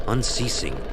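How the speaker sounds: ordinary delivery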